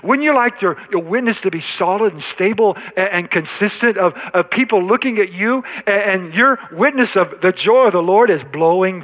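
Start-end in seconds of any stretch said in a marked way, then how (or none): none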